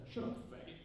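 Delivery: breathless half-whispered punchline; whispered joke. Retold or imitated. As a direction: toss it off under your breath. Friend's joke line, brainstorming.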